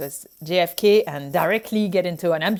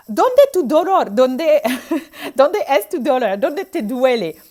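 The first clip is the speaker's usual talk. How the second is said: Spanish with a French accent, higher and louder, a bit hammed-up; 4 semitones higher, 5 dB louder than usual. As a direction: play a French doctor fumbling through Spanish, half laughing. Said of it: "trying to put an accent".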